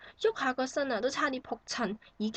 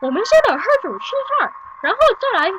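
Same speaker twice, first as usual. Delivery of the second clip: fast, much higher and louder Mandarin, hammed-up; mocking Mandarin neighbours; 6 semitones higher, 8 dB louder, faster than usual. Mother mocking noisy neighbours next door, loud fast hammed-up Mandarin. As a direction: mimic rowdy mainland patients ordering a feast. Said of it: "一時呢，就喺度".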